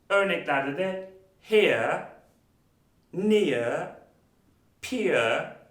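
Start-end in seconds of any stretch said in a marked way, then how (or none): 1.4-5.6 "here, near, peer" slow, ending in "uh"; one drawn-out word at a time, r-less "here, near, peer"; "ear" diphthong. Shown as a demonstration. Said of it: "ear şeklinde okuyoruz".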